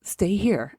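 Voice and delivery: normal talking voice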